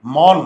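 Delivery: "মন" with an open o, as mawn, said loudly; mispronunciation demo. Shown as a demonstration. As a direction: give "মন" the wrong open vowel, like English "lawn". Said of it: "মন না মন".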